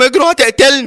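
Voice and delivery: high, urgent acted alarm; panicked warning. Fleeing patient warning his friends, panicked. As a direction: urge your friends to run, pitched right up.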